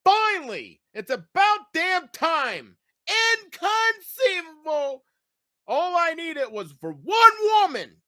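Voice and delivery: high, slow, grand mock voice; a high, slow put-on dog voice sighing finally; dramatic pet voice. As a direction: ham up the dog's triumphant relief. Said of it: "mock dramatic voice of dog".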